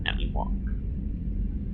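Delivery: slow, soft breathy signature; soft drawn-out sign-off. Shown as a demonstration. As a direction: breathe the name out slowly as a sign-off. Signing off the email as Wong.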